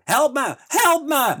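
hammed-up desperate scream; screaming for help. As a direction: yell it like the boss trapped in rubble. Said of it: "ligt te schreeuwen".

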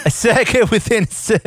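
a laughing attempt at posh British; failed British accent with a laugh; botched British accent. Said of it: "attempt at a British accent that sounded more South African".

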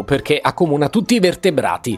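ordinary delivery

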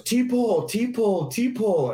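high, loud mocking call of the play; mock taunt. Old vet taunting the young blocker, high mock call. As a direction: taunt him like you know the play. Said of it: "he just points at me and he goes".

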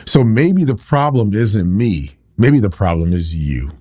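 healed man confronting his doubters, passionate preaching; preaching at full force. Acted. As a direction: drive it home to the congregation.

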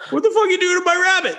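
high-pitched, rapid mock outrage; outraged. Rabbit's owner walking in on the mess, outraged. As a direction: imitate a shocked friend squawking. Owner's shocked outburst, retold.

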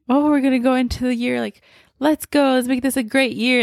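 rushing gleefully into the new year; excited. Retold or imitated.

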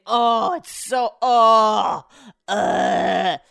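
loud, slow, drawn-out moans of delight; drunk gushing. Retold or imitated. Husband gushing to the bartender, loud drawn-out delight. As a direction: overdo the delight like a tipsy fan. Brett raving over drink.

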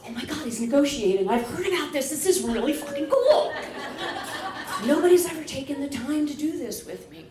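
high, loud, thrilled rush; gushing amazement. Retold or imitated. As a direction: build into giddy, mock-breathless wonder. Acted amazement at being negotiated with.

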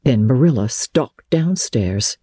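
usual speaking voice

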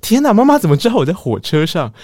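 mild startled inner voice; inner panic. Retold or imitated. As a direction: panic that mom knows. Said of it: "然后我就想说".

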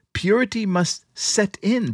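ordinary talk

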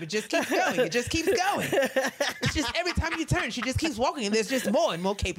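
high, laughing gush about the cape; imitating Andre. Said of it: "He was like".

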